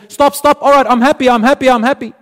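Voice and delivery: loud, high-pitched excited protest; overwhelmed excitement. Acted. Man telling God to stop giving, overjoyed outburst. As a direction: cut in high and loud, bursting with joy.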